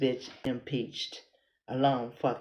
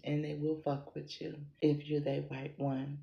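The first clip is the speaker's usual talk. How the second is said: ordinary talk, nothing marked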